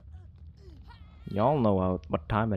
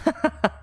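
high cackling laugh; delighted laugh. Real, not acted. Player laughing after a nice play, delighted.

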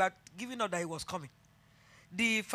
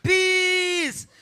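high, loud, drawn-out proclamation; preached peace; 9 semitones higher, 12 dB louder than usual. Acted. Preacher proclaiming peace to the congregation. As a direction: ring it out from the pulpit.

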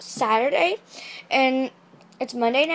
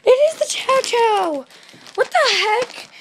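high, loud shocked cry; can't believe it's here; 12 semitones higher, 10 dB louder, slower than usual. Real, not acted. Surprise at the parcel.

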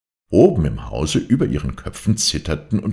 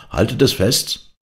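higher, louder shout against the storm; shouting servant; 8 semitones higher, 4 dB louder than usual. A narrator's reading. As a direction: shout to the mother over the wind. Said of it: "schrie Jon ihr zu".

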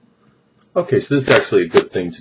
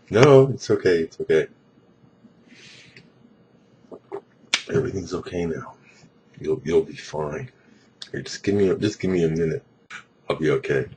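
self-reassuring; 3 semitones lower, 5 dB quieter than usual. Real, not acted. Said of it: "I guess you'd call it a panic attack".

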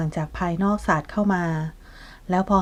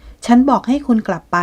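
higher and louder, ordering him out; angry shout; 5 semitones higher, 6 dB louder than usual. Acted. Kasma, sick in bed, orders the unwanted visitor out, an angry shout.

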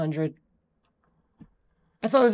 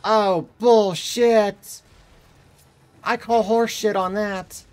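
loud, indignant outburst at the game; loud gamer protest; 8 dB louder, slower than usual. Real, not acted. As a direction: call it out loudly, outraged.

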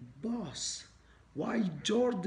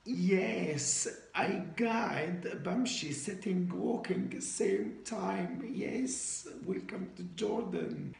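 put-on foreigner speaking broken Arabic with an accent; Arabic with a Western foreign accent; 2 semitones higher than usual. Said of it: "إحنا لازم نحكي عربي مكسر".

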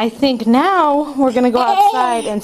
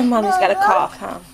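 ordinary delivery

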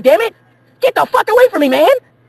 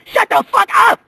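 angry shout; 12 semitones higher than usual. Acted.

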